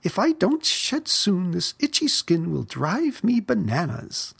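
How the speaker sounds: high, complaining whine; whining. A narrator's reading. Itchy Aggie complaining about her skin, high whine. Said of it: "whined Aggie".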